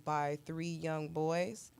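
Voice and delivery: usual speaking voice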